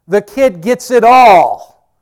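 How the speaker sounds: high, loud, drawn-out announcement; big punchline delivered at full volume; triumphant proclamation. Acted. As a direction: belt it out slowly, stretching the last word. Proclaims what the will says.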